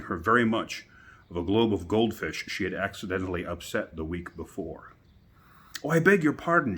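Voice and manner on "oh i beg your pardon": high, loud dismayed exclaim; great dismay. A narrator's reading. Alice apologising to the toppled jurymen, dismayed and loud. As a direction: apologise in a flustered rush. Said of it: "she exclaimed in a tone of great dismay".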